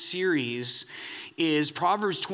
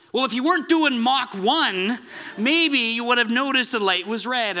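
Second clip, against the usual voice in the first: louder, higher, hammed-up officer scolding; mock stern cop; 6 semitones higher, 4 dB louder than usual. Police officer scolding the speeding driver, mock stern and louder. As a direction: play the cop lecturing a speeder, half-joking.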